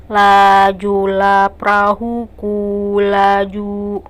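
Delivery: lajulah perahuku laju on one level note, very slow, no tune; flat monotone. Shown as a demonstration. Teacher shows a line without melody, slow flat monotone. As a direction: drone it slowly on a single pitch. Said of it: "jadi tidak lempeng seperti".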